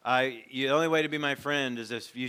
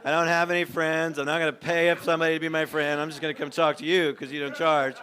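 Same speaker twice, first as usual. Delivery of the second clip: louder, higher voice of a friendless man; John's lament; 5 semitones higher, 8 dB louder than usual. Retold or imitated. John in the pastor's office, loudly complaining he has no friends. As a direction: plead it loud, as if nobody listens. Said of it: "I think of a guy I knew named John".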